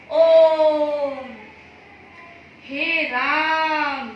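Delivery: ओम and हे राम pulled out very long and loud; prolonged vowels. Shown as a demonstration. Teacher showing children the extra-long vowel. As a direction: chant ओम, then stretch हे राम slowly. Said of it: "इनको कितना लंबा खींचा".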